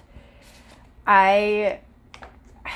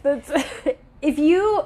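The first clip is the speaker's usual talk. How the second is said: laughing while talking, slower; 6 semitones higher, 3 dB louder, slower than usual. Real, not acted. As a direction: giggle through the words. Laughing mid-sentence.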